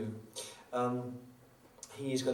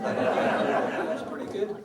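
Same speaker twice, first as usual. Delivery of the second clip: laughing; 8 semitones higher, 5 dB louder, much slower than usual. Real, not acted. Laughing at his own impression.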